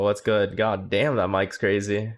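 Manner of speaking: normal talking voice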